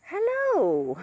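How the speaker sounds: high, loud hello swooping down; Missus Doubtfire. Retold or imitated. Owner showing her bird's other hello, high hooting impression. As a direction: sing out a fluty, high-pitched greeting. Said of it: "kinda like Missus Doubtfire".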